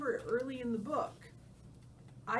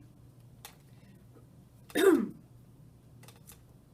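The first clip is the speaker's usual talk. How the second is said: throat clearing; 7 semitones higher, 8 dB louder than usual. Real, not acted.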